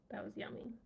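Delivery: whispered, almost silent. Real, not acted. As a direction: breathe it out as a secret. Whispered memory of bread.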